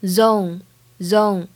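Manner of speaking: "Zone" drawn out slowly with a full, rounded O glide; full O diphthong. Shown as a demonstration. Teacher demonstrates "zone" for learners, slow with a full diphthong. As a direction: give the vowel in "zone" its whole length. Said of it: "要发音饱满".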